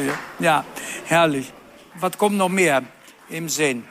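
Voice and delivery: usual speaking voice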